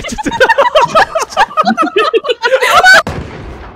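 high loud cackle; shrieking laugh. Real, not acted. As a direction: howl with laughter. Huge laugh.

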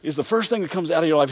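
ordinary talk, nothing marked

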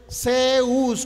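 Zeus loud, its E and U pulled apart; diphthong demo. Shown as a demonstration. Teacher comparing diphthongs, Zeus loudly sounded out. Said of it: "¿Cuál es el diptongo de Zeus?".

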